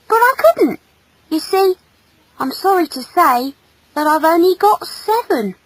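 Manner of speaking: earnest, high-pitched confession; confessing boy. Acted. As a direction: admit it shyly in a boyish voice. Boy confesses having seven arms.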